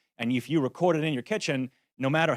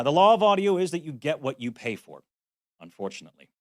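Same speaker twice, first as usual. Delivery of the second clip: normal talking voice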